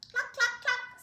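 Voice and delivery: high clucking like a hen. Acted. Reading Hen's line to kids, high clucking. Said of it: "said Hen".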